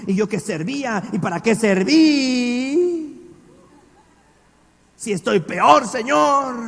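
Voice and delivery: building to a loud, slow shouted complaint; reproachful complaint. Retold or imitated. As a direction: rise from grumbling to shouting at God. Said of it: "Y le está reclamando".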